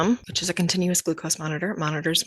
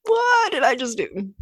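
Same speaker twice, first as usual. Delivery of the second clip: a laugh, then higher dismayed oh shit; mock alarm; 7 semitones higher, 4 dB louder than usual. A mom realizing she just jinxed her day, laughing in mock dismay. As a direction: realize the mistake with a laughing gasp. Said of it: "I thought to myself".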